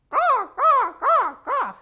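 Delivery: high, loud crow caws; loud squawking crow. Acted. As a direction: caw like an angry crow. Crow cawing.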